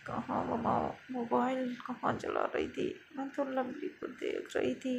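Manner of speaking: soft mumbled protest to mom; quiet, groggy murmuring; sleepy mumbling. Acted. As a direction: mutter it drowsily under your breath. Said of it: "क्या बड़बड़ा रही हो".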